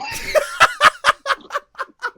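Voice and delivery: loud high-pitched cackle; high shrieking laugh. Real, not acted. Laughter burst.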